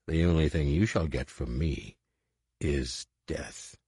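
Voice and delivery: low, soft and deadly calm; lethal deliberation. Acted. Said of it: "With lethal deliberation".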